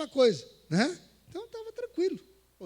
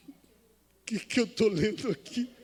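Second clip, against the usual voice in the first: bewildered; 5 semitones higher, 7 dB louder, slower than usual. Retold or imitated. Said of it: "Aí eu parei e falei assim".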